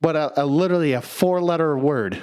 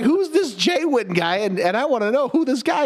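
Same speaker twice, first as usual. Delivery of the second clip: pitched-up bewildered disbelief; incredulous; 11 semitones higher than usual. Retold or imitated. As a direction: ask who this stranger is in mock confusion.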